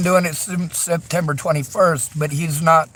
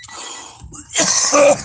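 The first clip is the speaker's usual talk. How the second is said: chesty cough; 12 semitones higher than usual. Real, not acted. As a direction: hack it out from the chest. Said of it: "I'm coughing".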